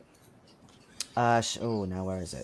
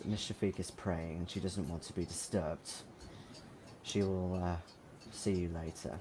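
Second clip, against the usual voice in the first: softer, lower, stern cleaner; 5 semitones lower, 9 dB quieter than usual. Acted. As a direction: warn them quietly and sternly. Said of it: "looks at you sternly and says".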